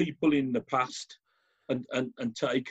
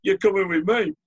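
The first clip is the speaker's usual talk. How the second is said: officer arresting; 8 semitones higher, 6 dB louder than usual. Retold or imitated. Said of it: "So he said".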